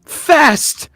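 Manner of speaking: high, loud, halting amazement; drunken Eustace. Acted. Drunk man pointing out what was drunk, halting and high. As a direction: blurt it in stunned, high bursts. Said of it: "Eustace just points and says".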